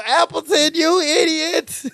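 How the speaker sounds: high, drawn-out wail of outrage; pained mock yell. Retelling shot boss yelling at Larry, pained mock yell.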